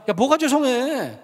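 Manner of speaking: re-enacted teacher's remonstrance, high and slow; exasperated retort. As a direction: scold fondly, stretching the words. Retorts to the apologetic student.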